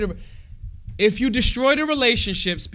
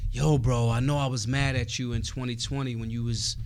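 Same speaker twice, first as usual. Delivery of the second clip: imitated relative; 8 semitones lower, 5 dB quieter than usual. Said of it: "How many people that are like".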